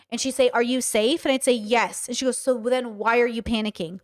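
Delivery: normal talking voice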